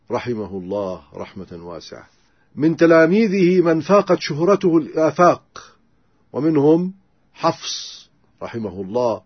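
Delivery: normal talking voice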